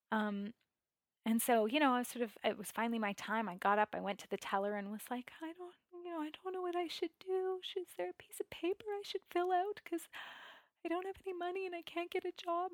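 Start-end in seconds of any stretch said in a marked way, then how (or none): 5.4-12.7 squeaky near-whisper. Retold or imitated. Broke woman asking the teller for help, squeaky whisper. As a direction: imitate a throat too tight to speak up. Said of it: "It was so squeaky and so".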